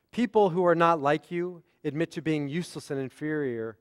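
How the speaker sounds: "People who are not like you" runs straight on into "admit"; no full stop after "you", fall on "inferior"; one flowing sentence. Shown as a demonstration. Rabbi reading Jane's flattery of John, flowing phrasing. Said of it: "the periods went and the emphasis went".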